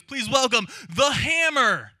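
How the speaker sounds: slow, loud, high hype-man shout; announcer hype. Retold or imitated. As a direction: stretch it out like a rock club MC. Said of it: "And he goes".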